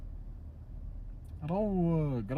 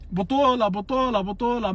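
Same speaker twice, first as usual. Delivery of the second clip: loud, higher, hammered repeats; emphatic shout; 6 semitones higher, 6 dB louder than usual. Real, not acted. Mocking a rival fan, shouting the word.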